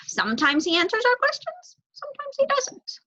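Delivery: rising to a high squeak; wry young crow. Acted. Crow describing the owl, rising high.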